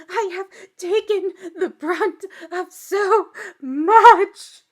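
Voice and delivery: loud, high, slow hammed-up wail; mock-crying, building to a drawn-out wail; dramatic mock sobbing. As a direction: imitate an overdramatic crier, dragging every word. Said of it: "It's so dramatic".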